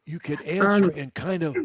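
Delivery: normal talking voice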